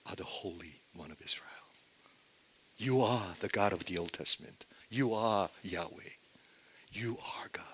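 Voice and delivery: soft, reverent declaration; hushed confession. Acted. Peter saying to Jesus who he is, in hushed reverence. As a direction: say it quietly, nearly whispering in awe.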